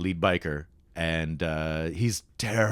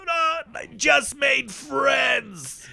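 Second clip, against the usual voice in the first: high, drawn-out hammed-up wail; acted dying scream; 3 dB louder, much slower than usual. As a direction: wail it slowly as a melodramatic death cry. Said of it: "And I scream, and then I just".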